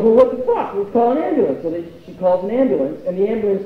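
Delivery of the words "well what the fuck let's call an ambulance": higher, louder exasperated mother; a mom panicking at her dying daughter; alarmed mom. Retold or imitated. Mom reacting to overdose.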